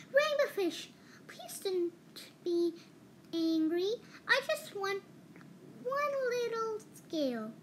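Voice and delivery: high, timid pleading little fish. A narrator's reading. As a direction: ask gently, hoping not to anger.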